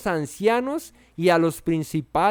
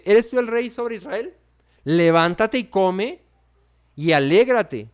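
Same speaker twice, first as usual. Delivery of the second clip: louder, emphatic commanding voice; slow, forceful orders to get up; rousing rebuke; 4 semitones higher, 5 dB louder, slower than usual. Acted. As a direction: shake him out of his sulk. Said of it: "aquí lo sacude un poquito".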